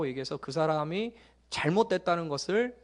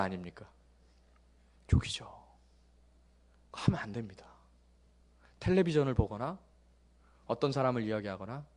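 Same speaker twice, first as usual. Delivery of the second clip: usual speaking voice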